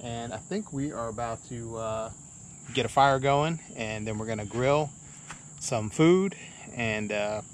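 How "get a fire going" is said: normal talking voice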